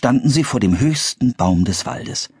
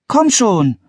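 high-pitched coaxing plea; pleading; 4 dB louder than usual. A narrator's reading. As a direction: coax him along, pitched high. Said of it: "bat Anne". (hoch und bittend)

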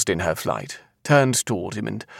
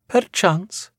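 high, assured Scottish seer voice; sure-toned seer; 13 semitones higher than usual. A narrator's reading. Seer answering the doubting man, high and sure. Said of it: "the surety of her tone".

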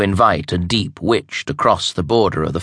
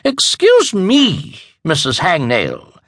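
much higher, slow, indignant; shocked rising voice; 10 semitones higher, 4 dB louder, slower than usual. Acted. Evangeline offended at the insult to the queen, much higher. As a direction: rise sharply in offended shock. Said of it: "Evangeline's voice rose several octaves".

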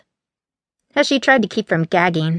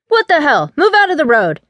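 loud, higher shout at the road; sudden alarmed shout; 8 semitones higher, 4 dB louder than usual. A narrator's reading. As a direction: yell in alarm at the figure ahead. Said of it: "Colton shouted".

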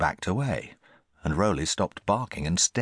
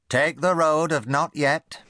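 put-on high, slow stern warning from an old aunt; great-aunt warning her nephew sternly; 11 semitones higher, 4 dB louder, slower than usual. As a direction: wag a finger as you warn the boy. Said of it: "she warned".

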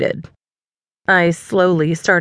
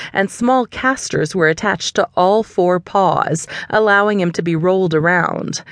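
plain reading voice

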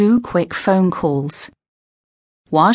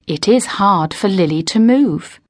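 ordinary delivery

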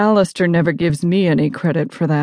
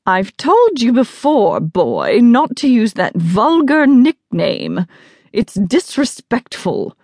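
high, slow, imperious reprimand; haughty scolding; 6 semitones higher than usual. Acted. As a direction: scold the boy with lofty disapproval. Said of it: "He's already glowering at my slip".

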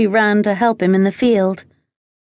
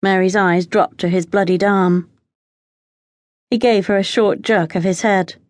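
usual speaking voice

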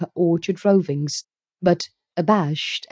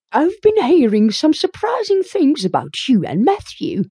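high, prying busybody woman; a nosy older neighbour fishing for news; gossipy neighbour voice; 9 semitones higher, 3 dB louder than usual. A narrator's reading. Rachel voiced in story.

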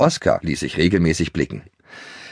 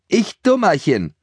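exclaimed; 11 semitones higher than usual. Acted. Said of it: "rief sie".

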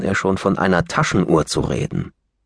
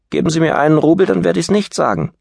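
high, bargaining tease; cheeky boy; 9 semitones higher, 3 dB louder, faster than usual. Acted. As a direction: demand it cheekily. Brat demands a rouble.

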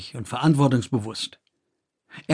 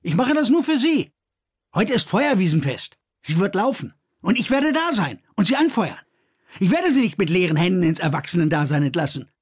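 higher, eager and proud; Kors, proud brother; 6 semitones higher than usual. A narrator's reading. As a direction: brother vows to honour his sister, proud and eager.

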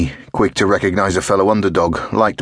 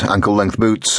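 ordinary delivery, nothing marked

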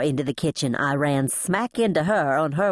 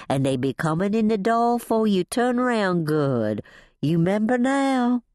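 coaxing Southern housekeeper, pitched up; soothing, humouring Lillian; 6 semitones higher than usual. A narrator's reading. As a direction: soothe as if to someone confused. Voices Lillian humouring her.

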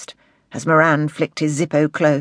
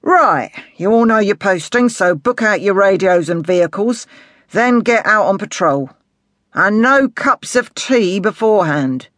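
brisk, emphatic sergeant, louder and higher; sergeant giving orders; 4 semitones higher, 5 dB louder than usual. Acted. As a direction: send the squad out on patrol with authority. Sergeant sends officers out.

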